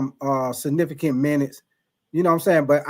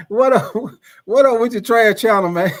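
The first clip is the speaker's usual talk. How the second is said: laughing, louder and higher greeting; 5 semitones higher, 5 dB louder than usual. Real, not acted. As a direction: greet loudly through laughter. Laughs while greeting a chatter.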